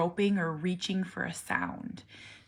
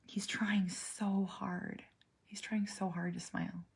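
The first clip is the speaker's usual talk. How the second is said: soft whisper; 9 dB quieter than usual. Real, not acted. Moved while explaining son's struggle.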